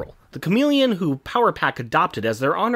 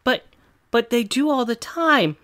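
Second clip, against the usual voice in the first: put-on higher, whining little boy; whiny kid voice; 6 semitones higher than usual. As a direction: pout like a four-year-old. Voicing whining Franklin.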